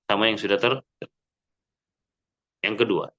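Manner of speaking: ordinary talk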